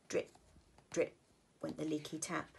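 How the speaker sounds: plain reading voice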